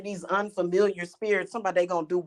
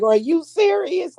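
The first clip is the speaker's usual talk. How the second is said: normal talking voice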